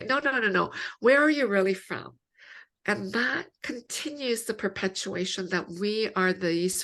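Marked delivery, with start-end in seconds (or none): 0.1-2.2 higher-pitched imitation of someone dismissing an answer; insistent questioner. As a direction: press the question as a mock doubter. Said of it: "they'll, they'll ask you".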